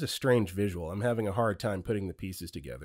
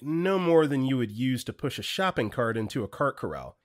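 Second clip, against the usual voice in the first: usual speaking voice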